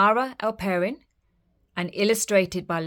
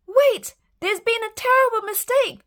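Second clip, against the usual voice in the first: loud, much higher desperate shout; girl shouting to the king; 8 dB louder than usual. Acted. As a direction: shout to stop them. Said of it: "shouted Isabella".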